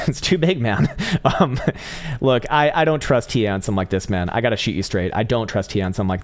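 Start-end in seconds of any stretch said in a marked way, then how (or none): 0.1-0.9 laughing. Real, not acted. Laughing at the oversized chart.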